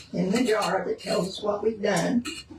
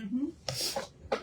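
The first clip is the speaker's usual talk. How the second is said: humming through a mouthful; mm-hmm while chewing; mouth full; 3 semitones higher, 7 dB quieter than usual. Real, not acted. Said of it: "With your mouth full?".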